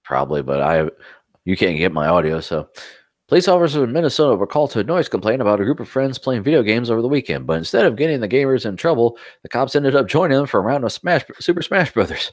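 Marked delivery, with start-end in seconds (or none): 3.3-12.3 brisk, higher-pitched article reading; quick lively news read; news read-aloud. Acted. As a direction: read the story out in a lively, rising-and-falling voice. Reads a news story aloud.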